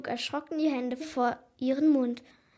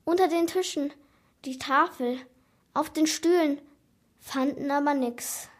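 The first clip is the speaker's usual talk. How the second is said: ordinary delivery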